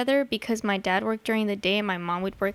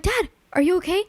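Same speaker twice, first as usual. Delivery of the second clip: mock-alarmed check, pitched well up; retold alarm; 8 semitones higher, 3 dB louder than usual. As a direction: act out a higher, worried question to a parent. Retold alarmed call to her dad.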